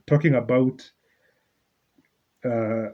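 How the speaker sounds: usual speaking voice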